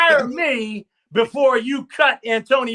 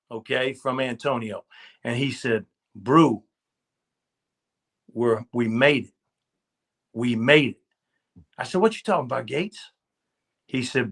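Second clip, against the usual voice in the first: tender, hushed recollection; 6 semitones lower than usual. Real, not acted. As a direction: let the memory soften the voice. Moving memory of Gates' call.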